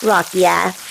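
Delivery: plain reading voice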